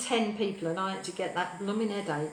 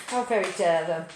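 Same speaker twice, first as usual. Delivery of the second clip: lower, louder mock indignation; mock outrage; 3 semitones lower, 4 dB louder than usual. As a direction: play at being scandalised.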